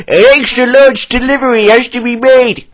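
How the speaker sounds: plant manager voice. A narrator's reading. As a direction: command it as the manager. Plant manager gives orders.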